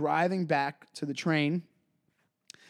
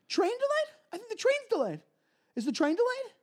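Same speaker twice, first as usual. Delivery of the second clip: much higher, nagging mock host; whiny host voice. Comic imitating the host asking about the train, much higher. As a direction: pester like the clingy host.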